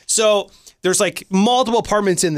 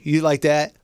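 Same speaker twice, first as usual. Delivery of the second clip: ordinary delivery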